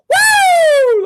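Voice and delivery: loud, high, sustained celebratory whoop; piercing fan's goal scream. Acted. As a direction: let out a long screaming "woo". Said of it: "Like, scream".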